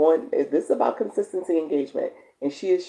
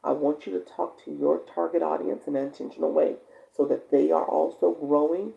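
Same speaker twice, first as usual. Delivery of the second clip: normal talking voice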